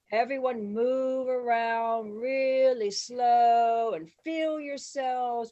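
drawn-out, loud and stretched vowels; slow-motion instruction. Retold or imitated. Director instructing extras on a club scene, very slow. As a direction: draw every word out very slowly. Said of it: "he instructed everyone. He goes".

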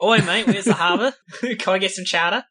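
put-on jokey hybrid Aussie-Boston accent; Boston-Australian accent. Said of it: "someone with a Boston accent doing an Australian accent".

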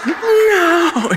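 high, shrieking mock witch cry; a hammed-up witch wail, half laughing; wicked witch shriek. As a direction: squeal it like the melting Wicked Witch of the West. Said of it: "the wicked witch of the West".

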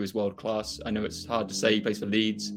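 ordinary talk

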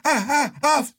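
high yelping ahs, hammed-up like a baby; mock baby screams. Patient as the nurse flips his arm, high mock baby yelps. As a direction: yelp it like a little baby getting hurt. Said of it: "screaming like a little baby".

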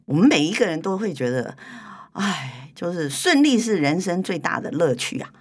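ordinary talk

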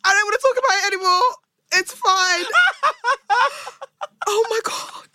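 high, tearful laugh-crying apology. Real, not acted. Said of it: "I'm genuinely crying".

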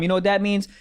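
ordinary talk

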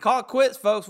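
big loud announcer sign-off; booming wrap-up; loud sign-off call. Real, not acted. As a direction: call it out to the crowd.

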